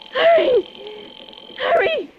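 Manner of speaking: high, frantic begging; frantic pleading. Acted. Woman begging the police to hurry, frantic. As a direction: urge them in terror. Begging operator as killer arrives.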